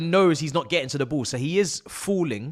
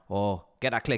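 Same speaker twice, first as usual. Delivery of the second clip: ordinary talk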